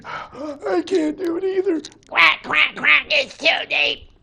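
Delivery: high-pitched quacking duck, winded; defeated duck. Acted. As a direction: quack it out after a failed dive. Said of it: "So duck says".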